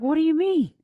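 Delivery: high-pitched, incredulous question; mock disbelief, pitch jumping up; taken aback. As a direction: act out a startled photographer. Photographer hears his work criticized.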